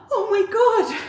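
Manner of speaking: gasp, then high loud shriek; high, overwhelmed fan scream; real shocked excitement. Real, not acted. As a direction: squeal it in disbelief, half laughing. Album reveal appears on screen.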